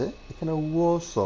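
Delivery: ওয়াস said high and very slow, no catch before it; smooth demo. Shown as a demonstration. Teacher showing the student where not to pause, slow. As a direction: glide through ওয়াস slowly with no stop. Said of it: "এখানে কেন গোত্তা খাচ্ছে".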